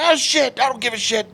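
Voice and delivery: high-pitched angry retort; defiant dad. Retold or imitated. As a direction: imitate a dad brushing off a threat. Angry dad defying the umpire.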